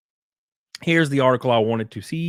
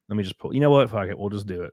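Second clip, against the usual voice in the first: usual speaking voice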